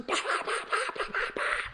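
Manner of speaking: rapid mouth-made gunshots; imitated gunfire. Two excited guns going off, imitated gunfire. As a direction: fire off a burst of pops. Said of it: "Then two guns".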